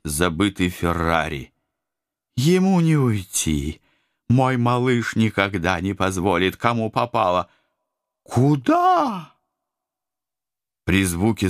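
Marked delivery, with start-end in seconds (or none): 2.4-7.5 lightly voiced, high and smug; a hint of a cocky huntress; confident female voice. Confident hunter woman. 8.2-9.3 high, startled outcry; shocked cry. Acted. Shocked vampire woman.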